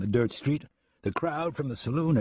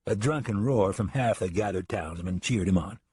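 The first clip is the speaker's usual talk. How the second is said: ordinary delivery, nothing marked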